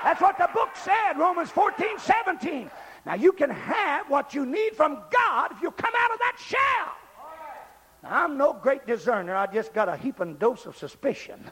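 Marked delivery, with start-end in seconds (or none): 0.0-6.9 high, building revival shout; full-force preaching. Real, not acted. Preacher saying church is more than socializing, full-force holler. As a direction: build each refrain to a shouted peak.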